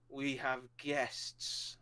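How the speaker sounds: hushed, measured commander; stern commander. Acted. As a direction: announce it slowly and quietly, with authority. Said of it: "He's, like, the commander of all of you".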